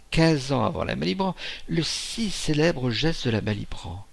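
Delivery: ordinary delivery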